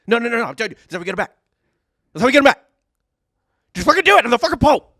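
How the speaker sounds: high, loud furious yelling; angry shouting. Acted. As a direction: scream it like a raging boss. Voicing the Pope, insisting.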